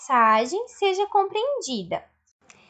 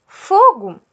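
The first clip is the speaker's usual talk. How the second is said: loud urgent call of fire, falling; shouting fire; 2 semitones higher, 9 dB louder than usual. Shown as a demonstration. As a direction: shout "fogo" like you spotted a fire. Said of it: "Você poderia falar". (grito de alerta: "fogo!")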